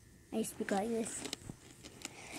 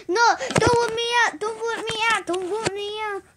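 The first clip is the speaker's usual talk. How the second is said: pleading; 6 semitones higher than usual. Acted. Begging not voted out.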